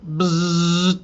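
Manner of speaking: buzzing trimmer noise, loud; imitated electric clipper buzz. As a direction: make the clippers go bzzz. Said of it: "and I go".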